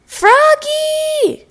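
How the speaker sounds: high, loud call across the field; teacher calling a child back. A narrator's reading. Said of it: "called his teacher".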